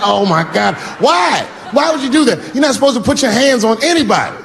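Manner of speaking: high shocked shout of why; shocked yelling. Retold or imitated. Dad shocked at son's punch, high yell. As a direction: yell in disbelief at the kid.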